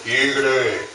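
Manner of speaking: deep, God-like summons; lion calling out. Acted. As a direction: call the tiger in a very low voice. Booming voice mistaken for God calls.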